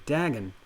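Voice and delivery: "Dagon" with short a, weak ending; first vowel of "Dagon" as in bag. Shown as a demonstration. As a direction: say "Dagon" as DAG-un, falling. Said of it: "Is it Dagon or Dagon?".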